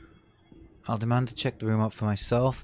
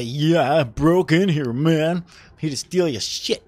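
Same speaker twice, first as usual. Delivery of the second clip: loud, brazen pretend admission; playfully over-frank and loud; mock confession; 3 semitones higher, 7 dB louder than usual.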